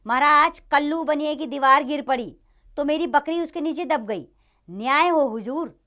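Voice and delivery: high, loud plea for justice; urgent complaint. Acted. Complainant pleads before king.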